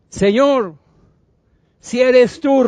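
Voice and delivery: acted earnest shout; calling out. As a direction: call across the water. Peter calls out to Jesus.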